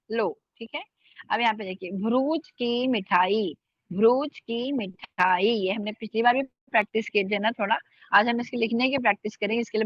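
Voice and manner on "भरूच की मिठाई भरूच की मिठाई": भरूच said slowly with an aspirated भ. Shown as a demonstration. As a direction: draw out भरूच with a puff on भ. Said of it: "भ भारी है". (धीरे, भारी भ के साथ भरूच)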